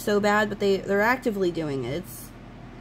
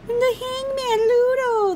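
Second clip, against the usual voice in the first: squeaky excited; 4 dB louder, slower than usual. Real, not acted. Excited at Ludo card.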